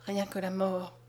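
soft, low-pitched, sombre murmur. A narrator's reading. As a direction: drop to a grave near-murmur. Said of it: "il ajouta si bas".

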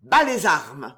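loud, firm command; authoritative. A narrator's reading. Stranger leaping between two duellists, authoritative. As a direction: order them with full authority. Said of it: "en disant avec autorité".

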